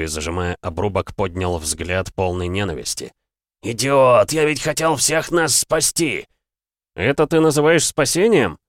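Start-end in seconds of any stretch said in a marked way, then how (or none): 3.6-6.3 громкий надрывный крик в отчаянии; кричать от боли и ненависти; яростный крик. Acted. Раненый бог в ярости. 7.0-8.6 громкий возмущённый выкрик; бросить в лицо с возмущением; гневный вопрос. Acted. Герой отвечает богу.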